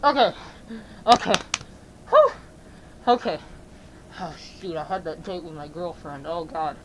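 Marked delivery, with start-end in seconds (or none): 4.2-6.8 low, hushed self-talk; dismayed muttering. Acted. Dismayed aside, talking to himself.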